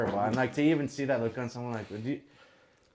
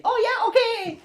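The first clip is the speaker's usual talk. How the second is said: much higher, louder chirpy reply; squeaky imitation of the pianist; mock piano lady; 6 dB louder, slower than usual. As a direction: play the piano lady brightly agreeing.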